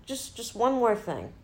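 lightly acted detective turning back; Columbo. Detective stops a suspect at the door, lightly imitated. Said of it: "he turns around and he's like".

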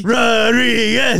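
slow, stretched shout of Rodriguez; drawn-out call. Acted. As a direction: call Rodriguez out slowly like an announcer. Calling out Lee's name.

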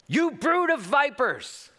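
high, slow hammed-up denunciation; mock fiery rebuke. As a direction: spit it out like an angry prophet. John voiced rebuking crowd.

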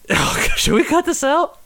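breaking into laughter, pitch way up; laughing. Real, not acted. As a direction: laugh through the question. Laughing mid-rant, checks with cohost.

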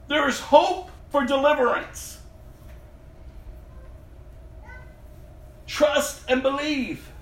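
high, ringing proclamation of freedom; fervent preaching. Acted. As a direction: lift the congregation with each word.

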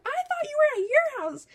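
put-on high, loud, bewildered drunk voice; mock-drunk high-pitched questioning; imitating drunk friend. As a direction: wail it like a confused drunk. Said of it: "she wasn't sober".

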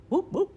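short high whoops; two high mimicked animal calls; imitated animal whoop. Imitating the call behind the tree, high whoops. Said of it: "I hear a".

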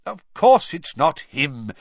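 loud, slow, emphatic denial; impatient. A narrator's reading. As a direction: dismiss the idea with exasperated stress. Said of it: "said Mister Weasley impatiently".

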